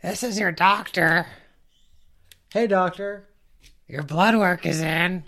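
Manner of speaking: doctor voice. Pretend. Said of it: "I'll do both voices".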